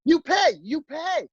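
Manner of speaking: high, drawn-out mock demand; hostess transformed. Hostess demanding payment from walkouts, high mock outburst. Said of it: "she turned into, like, this".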